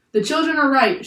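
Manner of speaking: high, loud declaration; shouted agreement. A narrator's reading. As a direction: shout over the crew. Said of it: "shouted the professor".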